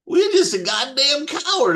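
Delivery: a general mocking a crying soldier, contemptuous. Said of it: "And Patton goes".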